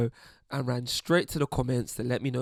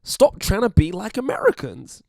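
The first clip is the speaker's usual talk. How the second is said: mock American accent, high and loud; 8 semitones higher, 6 dB louder than usual. Voicing angry commenters attacking a Brit, mock American accent. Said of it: "I don't know what accent this is".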